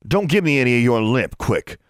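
put-on older boy snapping at a cadet; snapped. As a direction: cut her off with a snap. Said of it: "he snapped".

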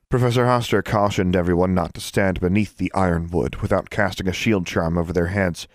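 ordinary delivery, nothing marked

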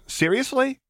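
put-on high, disbelieving teenage boy; incredulous teen. Teen boy's incredulous retort.

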